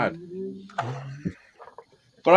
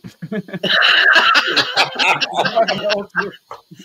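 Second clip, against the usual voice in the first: big laugh; 4 semitones higher, 5 dB louder than usual. Real, not acted. Laughing at own story.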